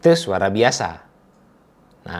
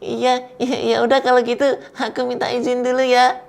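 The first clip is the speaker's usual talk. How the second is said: high, fast playful character voice; 13 semitones higher than usual. Acted. Storyteller opens with a high, fast character voice. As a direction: chirp it out like a cartoon character.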